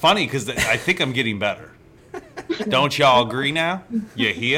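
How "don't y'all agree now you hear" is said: slow Southern accent, louder at first; attempted Southern accent. Shown as a demonstration. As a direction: freestyle a folksy Southern accent. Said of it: "with a Southern voice instead of my voice".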